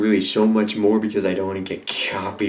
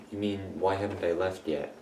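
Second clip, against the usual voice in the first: ordinary talk, nothing marked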